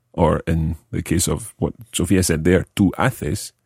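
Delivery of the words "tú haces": ordinary talk, nothing marked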